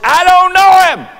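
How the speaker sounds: very high, loud, drawn-out shout; angry denial. Acted. Peter denying Jesus a third time, angry shout. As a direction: yell the denial at full force.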